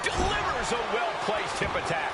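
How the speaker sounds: live play-by-play, building to a shout; wrestling commentator. Acted. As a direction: call the action ringside, rising to the big move. Play-by-play of a match.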